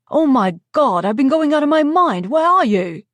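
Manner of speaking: loud, high and frantic; frantic worry. Acted. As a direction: blurt it out in a panic. Worried caller finally reaches her.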